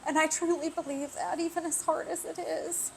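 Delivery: holding back tears while speaking; teary. Real, not acted. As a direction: voice on the edge of tears. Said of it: "I really didn't think I was gonna get teary".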